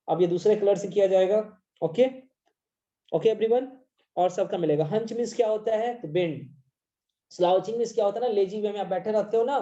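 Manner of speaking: ordinary talk, nothing marked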